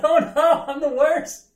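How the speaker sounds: high, loud, giggling mock despair; laughing embarrassment. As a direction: squeal at your own embarrassment. Mock self-shame, laughing.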